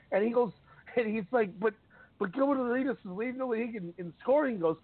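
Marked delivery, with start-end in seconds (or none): 1.6-4.6 high, whiny mock of the rival voice; lightly voiced mock whine; mocking retort. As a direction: parody the guy's weak argument. Mocking the other side's argument.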